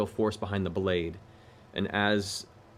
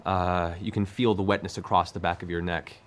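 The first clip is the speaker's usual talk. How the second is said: ordinary delivery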